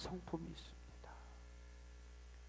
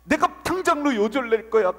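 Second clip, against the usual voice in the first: roaring, much louder and higher, in God's wrath; furious roar; 9 semitones higher, 17 dB louder than usual. Acted. Preacher voicing God against those who hurt His children, furious roar. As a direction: bellow the threat like an outraged father.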